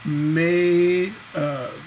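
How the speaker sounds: "made" stretched out long, "of" left dangling; slow-motion feminine ending on "made of"; stress on MADE. Shown as a demonstration. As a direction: draw out "made", then a weak falling "of". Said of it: "That stress is on made".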